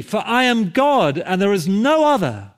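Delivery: high, ringing pulpit proclamation; imitated preacher at full lift; impassioned preacher. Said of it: "he went on in this way".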